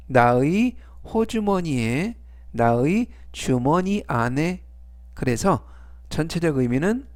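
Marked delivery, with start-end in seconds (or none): none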